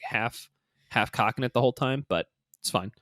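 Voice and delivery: ordinary talk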